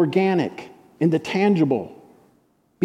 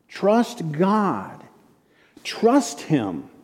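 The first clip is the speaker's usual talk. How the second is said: preaching; 6 semitones higher, slower than usual. Acted. Pastor urging the congregation to trust God, slow rising sermon swell. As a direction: lean in and preach it, drawing out each phrase.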